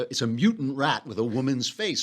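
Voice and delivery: ordinary delivery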